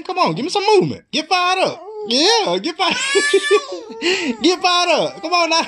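high, laughing cheer; laughing encouragement. Real, not acted. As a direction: laugh while you hype her up. Dad laughing while urging baby.

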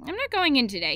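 dad's firm announcement; announcing. A narrator's reading. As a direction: state it decisively. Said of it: "he announced".

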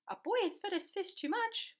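astonished, questioning storybook voice; incredulous question. A narrator's reading. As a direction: repeat it back in disbelief. Fireman repeats it as a question.

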